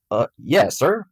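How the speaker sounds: plain reading voice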